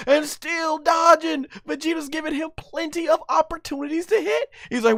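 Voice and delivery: high, excited fight commentary; live play-by-play. Acted. Fan narrating an anime fight to viewers, hyped play-by-play. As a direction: call the action like a ringside announcer.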